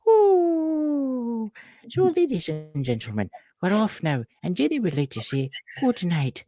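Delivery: high, hammed-up Irish sign-off; high mock Irish goodbye to the viewers; mock Irish announcer. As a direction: sign off the show like a stage Irishman. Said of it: "when I talk Irish".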